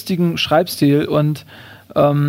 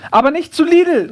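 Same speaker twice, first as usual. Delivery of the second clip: high mock call, only a little louder; shout-after, performed with raised pitch; calling after someone; 10 semitones higher, 3 dB louder than usual. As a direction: call a last instruction after them. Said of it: "ruft mir das Känguru hinterher".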